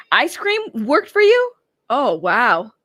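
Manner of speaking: disbelief at a chat cure; surprised. Real, not acted. Reading chatter's hangover cure.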